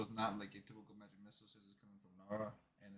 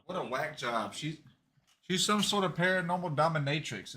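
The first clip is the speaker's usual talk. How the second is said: excited outburst; 6 semitones higher, 10 dB louder, slower than usual. Real, not acted. Player reacts loudly to the scene.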